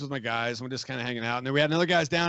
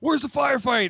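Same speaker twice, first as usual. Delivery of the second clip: higher, urgent retold question; pitched-up mock call; eager newcomer calling out; 8 semitones higher, 4 dB louder than usual. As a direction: imitate someone running up looking for action. Officer runs up asking, retold.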